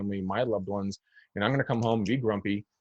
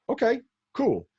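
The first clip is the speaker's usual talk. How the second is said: much higher, sarcastic "cool"; sarcastic okay; 13 semitones higher, 3 dB louder than usual. Real, not acted. Mocking the desperate agent, sarcastic high brush-off. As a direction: brush it off with mock cheer.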